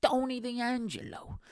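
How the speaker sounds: high, stretched Italian-American wrestler voice; Tony D'Angelo. Acted. Podcaster repeating the wrestler's name, exaggerated Italian-American accent. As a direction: draw it out in caricature Italian-American. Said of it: "He literally sounded like that".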